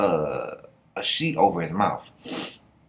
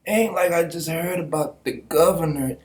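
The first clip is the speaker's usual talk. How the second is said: higher, slow mock voice of a friend; lightly put-on higher voice, half-amused; imitating her; 8 semitones higher than usual. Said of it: "she was like".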